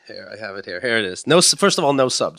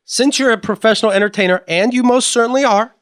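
reading dad's email; 8 semitones higher, 5 dB louder than usual. Retold or imitated. Rereading dad's email line.

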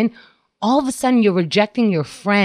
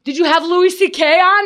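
mock eager fan; 9 semitones higher than usual. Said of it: "they're like".

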